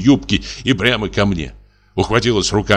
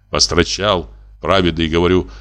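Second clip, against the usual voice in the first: ordinary delivery, nothing marked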